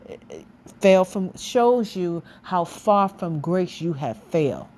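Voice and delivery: ordinary talk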